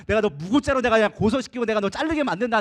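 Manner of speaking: loud, re-enacted angry threat; boiling anger. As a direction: vow revenge with rage surging. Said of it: "화가 여기까지 올라왔다가". (화가 치밀어 내뱉는 협박 재연)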